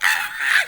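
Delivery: high, very loud re-enacted scream; startled scream. Child caught peeking by Papa, re-enacted terrified scream. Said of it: "And I screamed".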